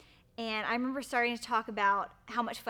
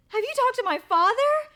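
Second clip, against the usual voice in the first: much higher and louder, breathless question; overjoyed fiancée checking he asked her father; excited squeal; 12 dB louder than usual. Retold or imitated. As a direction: ask it almost shrieking with joy. Excited question during proposal.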